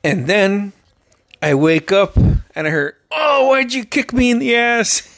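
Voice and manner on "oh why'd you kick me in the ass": high-pitched wail of complaint; hammed-up indignant whine; mock pained outcry. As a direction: imitate someone rudely kicked awake. Acting out the kicked woman waking.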